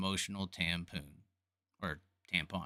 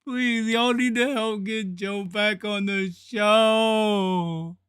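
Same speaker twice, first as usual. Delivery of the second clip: fake crying plea; 6 semitones higher, 8 dB louder, slower than usual. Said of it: "Did it look like I was crying?".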